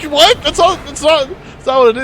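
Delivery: high, loud disbelieving protest; shocked disbelief. Retold or imitated. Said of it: "I'm like".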